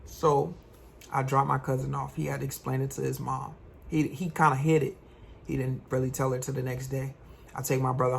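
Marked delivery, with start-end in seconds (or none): none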